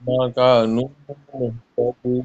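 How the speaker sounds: كانوا with long و, slowly; slow model recitation. Shown as a demonstration. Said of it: "نون کے بعد واو ہے نا". (آہستہ تلاوت، كانوا میں واو لمبا)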